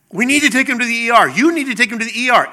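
mock-urgent insisting; insistent wife. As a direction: press a spouse urgently, voice up.

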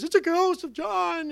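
a hammed-up scared gasp; mock spooked. Herod's fear mimicked.

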